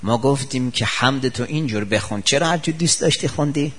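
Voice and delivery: ordinary talk, nothing marked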